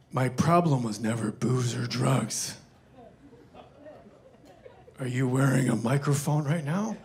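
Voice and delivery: low, slow imitation of the director; Clint Eastwood. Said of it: "And he goes".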